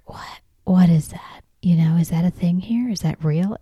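hushed, urgent retold whisper. Wife whispers to husband about the billboard, imitating a whisper. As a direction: whisper it privately so the kids miss it. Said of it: "and we whisper".

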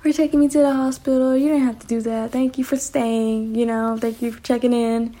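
grateful thanks. Retold or imitated. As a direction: thank her gently and sincerely. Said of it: "I was like".

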